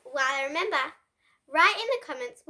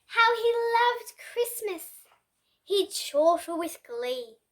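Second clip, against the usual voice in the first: ordinary delivery, nothing marked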